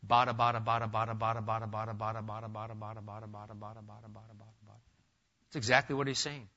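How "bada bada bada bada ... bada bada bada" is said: endless mindless repetition fading away; mock babbling. Preacher mocking empty repetitive prayer, mock babble fading out. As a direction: babble it on and on until it dies out.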